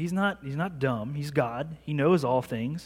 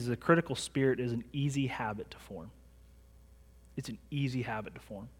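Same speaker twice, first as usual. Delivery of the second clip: whispered, much softer; hushed warning; 4 semitones lower, 8 dB quieter than usual. Acted. Pastor warning the congregation about judging, hushed. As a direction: lean in and warn them softly.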